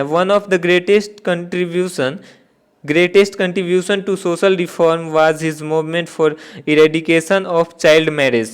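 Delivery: slow, measured reading; dictation pace. Shown as a demonstration. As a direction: read the question slowly for the class. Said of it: "reads question aloud slowly". (धीमे धीमे प्रश्न पढ़ना)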